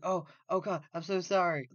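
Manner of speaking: mock-flustered apology, high and loud. Woman apologising after an unintended slight, flustered.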